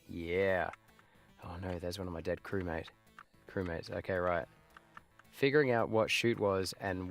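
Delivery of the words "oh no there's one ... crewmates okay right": ordinary talk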